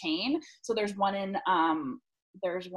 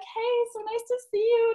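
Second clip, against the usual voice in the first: much higher, sugary hello; sweet greeting; 13 semitones higher, 4 dB louder than usual. Retold or imitated. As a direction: gush like a friendly star. Star greets them sweetly.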